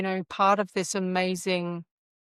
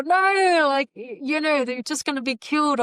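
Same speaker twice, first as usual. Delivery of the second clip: screaming warning; 7 semitones higher, 4 dB louder than usual. Pretend. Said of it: "what would they be screaming out at you".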